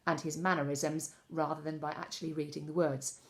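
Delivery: ordinary delivery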